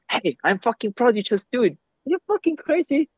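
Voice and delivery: high, excited hype voice; acted-out thrilled supporter; enthusiastic cheering on. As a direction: gush it with pitch leaping up at the end. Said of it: "It's something different when you share it like this".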